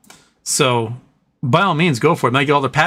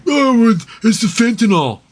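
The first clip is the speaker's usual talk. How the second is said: mock Trump; 7 semitones higher, 3 dB louder than usual. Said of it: "He's like".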